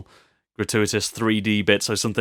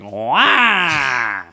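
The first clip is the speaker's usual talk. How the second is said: a short, louder mock cry, half pained and half excited; Waluigi wah; 3 semitones higher, 4 dB louder than usual. Shown as a demonstration. Host inventing a new Mario score sound, mock Waluigi wah. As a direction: yelp it like the cartoon villain's signature wah. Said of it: "maybe a Waluigi sound".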